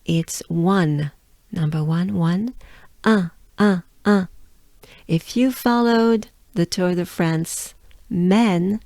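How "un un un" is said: un as a short French nasal vowel; crisp repeated un, French nasal; French un sound. Shown as a demonstration. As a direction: demonstrate the nasal un, each one separate. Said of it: "you already know this sound".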